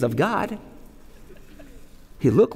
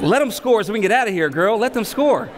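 high, fast laughing plea; pleading aside; 7 semitones higher than usual. Retold or imitated. Husband urging his wife to let them score, fast and high. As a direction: rush it like a tired parent.

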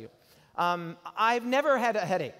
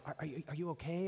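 worried hush; 8 dB quieter, faster than usual. Retold or imitated. Father whispers to son.

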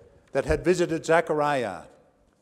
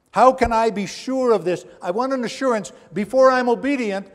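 insistent demand; 6 semitones higher, 5 dB louder than usual. Acted. Preacher voicing Zechariah's demand.

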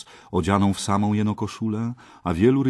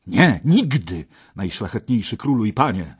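louder, higher refusal; exclaiming; 4 semitones higher, 7 dB louder than usual. A narrator's reading. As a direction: cry out your refusal to the king. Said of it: "wykrzyknął Gawain".

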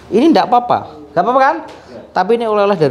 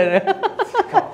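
short high laugh; 5 dB quieter than usual. Real, not acted. Healer laughing along with the giggling patient, short and high.

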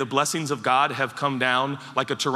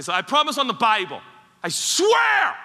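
building to a high shouted swear; mock oath; 7 semitones higher, 4 dB louder than usual. Pastor mimicking someone over-swearing, rising to a mock shout. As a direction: ham up the desperate promise.

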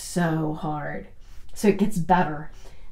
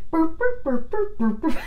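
puppy burps; 7 semitones higher than usual. Retold or imitated. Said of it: "my puppy was like".

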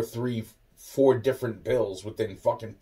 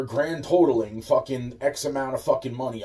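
usual speaking voice

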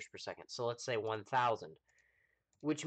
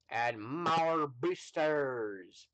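hammed-up battle cry; 3 semitones higher, 4 dB louder, much slower than usual. Gleefully overdoing the rocket build.

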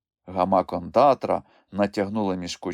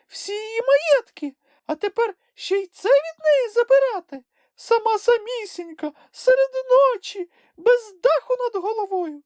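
very high, squeaky emotional plea; high little creature, overcome with feeling; Sniff moved to pity; 4 dB louder than usual. A narrator's reading. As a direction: plead for the lonely one in a tiny voice. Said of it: "Голос Чмиха затремтів від розчулення".

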